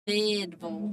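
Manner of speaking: Fayetteville clipped to a short FEDBL; Southern pronunciation. Shown as a demonstration. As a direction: guest teaching the host the Southern Fayetteville. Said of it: "it's Southern slang".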